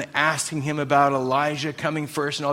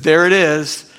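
loud, ringing affirmation; triumphant exclaim; 8 dB louder than usual. Acted. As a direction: hit it loud as a joyful verdict. Jesus praising the woman's faith.

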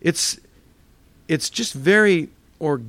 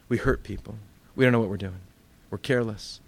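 normal talking voice

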